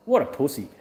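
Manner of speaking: ordinary delivery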